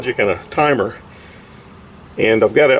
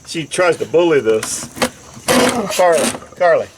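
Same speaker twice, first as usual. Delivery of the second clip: dog command voice; 6 semitones higher, slower than usual. Real, not acted. Commanding dog to press button.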